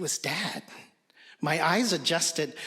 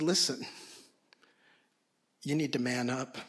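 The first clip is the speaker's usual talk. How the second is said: softer, lower, gentle words; tender fatherly advice; 3 semitones lower, 3 dB quieter than usual. Retold or imitated. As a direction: comfort him quietly while holding him. Dad hugs and advises son.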